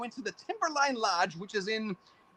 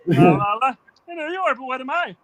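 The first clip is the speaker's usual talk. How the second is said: louder sing-song in a put-on childish voice; Pee Wee Herman impression; 2 semitones higher, 8 dB louder than usual. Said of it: "Pee Wee Herman, yes".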